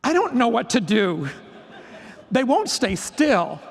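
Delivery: hammed-up exasperated despair; little meltdown. New teacher falling apart in front of the mentor, mock meltdown. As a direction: complain helplessly, playing up the overwhelm. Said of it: "I had a little meltdown".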